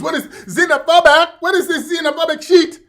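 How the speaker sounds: put-on high, loud indignant Nigerian accent; hammed-up, outraged West African accent, high-pitched; mock Nigerian accent. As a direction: protest in an exaggerated Nigerian accent. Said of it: "Mister Nigerian".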